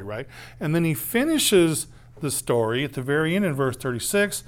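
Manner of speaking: ordinary talk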